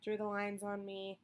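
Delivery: normal talking voice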